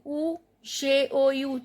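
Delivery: ordinary delivery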